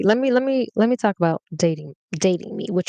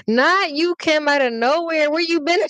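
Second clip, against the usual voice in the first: high, louder, delighted call-out; excited greeting; 9 semitones higher, 3 dB louder than usual. Real, not acted. Greeting Kim in the comments, delighted high call-out.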